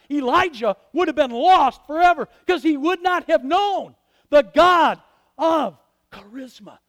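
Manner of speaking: loud, high, driving sermon climax; preaching at full force. Acted. Preacher thundering at the congregation about the loud God. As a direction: pound it out to the back pews.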